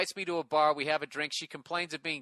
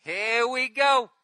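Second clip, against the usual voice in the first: drawn-out dread; 8 semitones higher, 8 dB louder, much slower than usual. Real, not acted. Dreading the herpes reveal.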